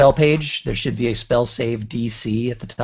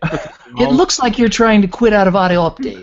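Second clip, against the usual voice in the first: cheery software assistant; 8 semitones higher, 4 dB louder than usual. Pretend. Imitating the Clippy assistant.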